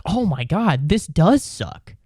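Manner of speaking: ordinary talk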